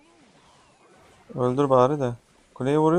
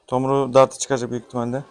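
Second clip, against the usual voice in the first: ordinary delivery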